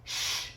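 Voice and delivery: long soft "shh"; calm shushing sound. Acted. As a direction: hush the storm with one drawn breath. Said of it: "he says to the storm".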